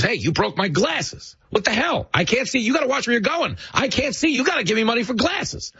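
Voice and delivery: high, whiny hustler accusing tourists; hustler act. Retold or imitated. Street hustler guilt-trips a tourist family, high whiny complaint. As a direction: play the con man faking outrage over broken glasses. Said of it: "drops his broken glasses on the ground and goes".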